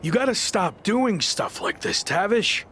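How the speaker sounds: high, slow exasperated plea; fed up, dragging the words out; complaining to the manager who tricked him. Acted.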